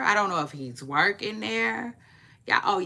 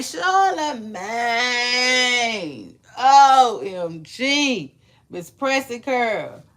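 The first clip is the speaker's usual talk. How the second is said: loud, high, long-stretched exclaiming; theatrical, slow disbelief; hammed-up shock; 5 semitones higher, 9 dB louder, much slower than usual. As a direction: drag each word out in mock outrage. Acting out her shocked reaction.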